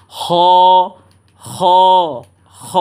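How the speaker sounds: হ said heavy and rasped from the back of the throat; thick throaty হ, three times; heavy kha. Shown as a demonstration. Teacher to students, a heavy throaty হ. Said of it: "মোটা আওয়াজে উচ্চারণ করবেন".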